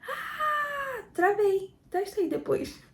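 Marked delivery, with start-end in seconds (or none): 0.1-1.8 high strained yell, then travei; a locked, strangled cry of pain; tense shout. Shown as a demonstration. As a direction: demo the bearing-down scream that locks up. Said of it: "berrando mesmo, você trava lá embaixo".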